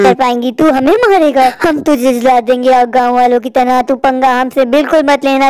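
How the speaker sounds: low taunting witch; mock the tantric.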